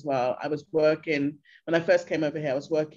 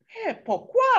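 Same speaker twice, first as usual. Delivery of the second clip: mock local, high and incredulous; baffled local; 3 dB louder, slower than usual. Local asking the Londoner why she came, high disbelief. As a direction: ask why like you truly can't fathom it. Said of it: "they all say".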